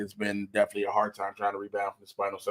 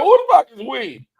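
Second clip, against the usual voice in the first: mock outrage; 13 semitones higher, 9 dB louder than usual. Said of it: "I was like".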